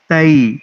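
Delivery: "تئی" said with a clear gliding ai sound; correct diphthong. Shown as a demonstration. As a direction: glide the vowel of "تئی" from a to i. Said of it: "یہ sound آنی چاہیے".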